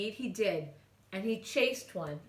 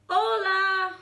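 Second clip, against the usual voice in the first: high, loud call across; hollering; 11 semitones higher, 9 dB louder than usual. A narrator's reading. Kitten hollering hello to his dog friends. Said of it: "hollered back Scapito Frisquito".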